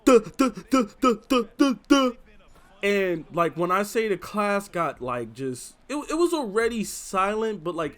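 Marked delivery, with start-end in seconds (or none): 0.1-2.1 loud, high mocking stammer; mean playground taunt at full volume; mocking stutter yell. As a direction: yell it out to mock her stutter. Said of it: "yell out at the top of my lungs".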